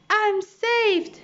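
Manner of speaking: high, relieved shout; joyful yell. A narrator's reading. Monkey yells with relief at the rescuing ship, high. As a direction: cheer at the rescue rope. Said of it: "yelled Chee-Chee".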